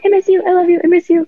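high, eager pleading; joyful reunion. Retold or imitated. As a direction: call out to a missed friend, eagerly. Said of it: "and I was like".

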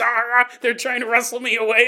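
ordinary talk, nothing marked

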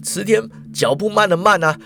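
usual speaking voice

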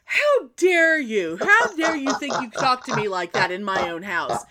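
mock-offended how dare you; mother-in-law mock-shrieking at her son; outraged mother-in-law. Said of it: "she's like".

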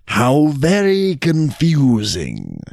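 slow, drawn-out bafflement; puzzled jackal. Acted. Jackal hears the Brahmin's tale, slow and confused. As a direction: stretch it out, mock-bewildered.

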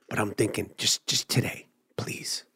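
whispered please; whispered pleading please; hushed plea. Real, not acted. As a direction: breathe the please out. Begging quietly at the DMV.

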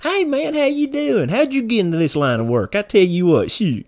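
put-on high-pitched, chummy country-boy twang; hillbilly accent. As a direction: play a backwoods Kentucky good old boy. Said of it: "I'm talking about our people".

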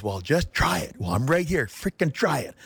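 rising pitch, retold heated dare; mock yelling. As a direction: imitate an angry coach daring someone. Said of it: "And he's yelling at me".

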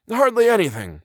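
high-pitched professor's reply; lifted, dismissive character voice; old professor's voice. A narrator's reading. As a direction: answer airily in a high old-scholar voice.

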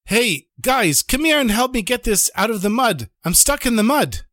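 high-pitched call for help; acted shout from a stranded driver. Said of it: "he shouts out to the Rebbe".

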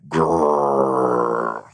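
low drawn-out playful growl; rolling mock-annoyed "grrr". Shown as a demonstration. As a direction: growl it, long and sinking. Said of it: "I might be like".

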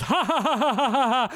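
high, rhythmic mock guffaw; imitated crowd laughter. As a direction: mimic a whole bar roaring with laughter. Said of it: "everybody in the bar goes".

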